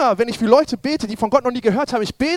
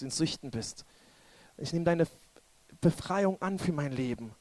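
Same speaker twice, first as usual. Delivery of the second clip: hushed, lower-pitched prayer; soft prayer; 5 semitones lower, 9 dB quieter than usual. Real, not acted. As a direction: lead them gently into surrender, softly. Leads soft prayer of surrender.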